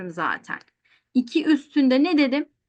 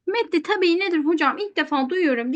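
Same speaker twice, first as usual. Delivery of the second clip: mock student question; 7 semitones higher than usual.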